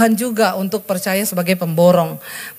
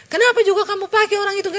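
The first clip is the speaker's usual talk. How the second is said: high, fast scolding of the husband; high, fast exasperated complaint; exasperated demand; 11 semitones higher, faster than usual. Retold or imitated. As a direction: snap the question at him in disbelief. Angry quote to husband.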